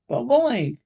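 high, loud protest; crying out. A narrator's reading. Nephew questioning his uncle's refusal, crying out. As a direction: cry out the question in dismay. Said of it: "cried Uncle Stuart".

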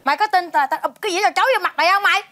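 sudden loud cut-off, then rapid threat; angry threat. Acted. Wife threatens to dump the plate on her, angry threat. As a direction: shut them up and threaten.

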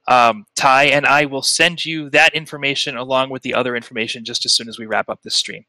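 ordinary talk, nothing marked

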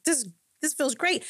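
high, eager retold delight; acted-out giddy contentment; elated. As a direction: let it bubble up high. Acts out joy at church.

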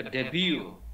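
"ডেবিউ" as de-byu, falling at the end; silent T in debut. Shown as a demonstration. Teacher repeats debut for the class, T silent. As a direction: model "ডেবিউ" with no T sound. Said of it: "ডেবিউ, টি হবে না".

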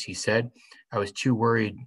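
usual speaking voice

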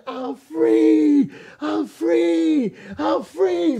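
high, loud, drawn-out shout; yelling. Retold or imitated. New convert yelling to the whole church, yelling. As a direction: cry out freedom, holding the last word long. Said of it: "I started yelling".

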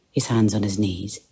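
ordinary delivery, nothing marked

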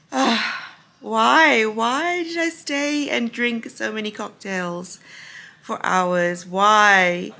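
loud groaning why; wailing at herself, half laughing; exasperated wail. Real, not acted. Regretful tipsy lament.

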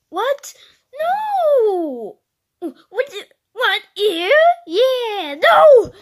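high, loud cries of disbelief; mock-dramatic outburst; acted shock. Acted shock at bad news.

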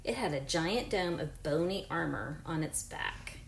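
clear, even read-aloud voice for a class; reading to children. Acted. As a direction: read the book to your pupils. Reads book aloud to class.

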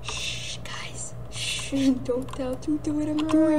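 soft whispered hush; whispered secret to friends. Real, not acted. Secret hushing to team.

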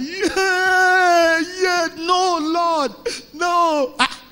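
hammed-up, high, loud wail; piercing, drawn-out acted crying. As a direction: wail in mock despair to heaven. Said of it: "she just collapsed as I cried".

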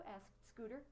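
usual speaking voice

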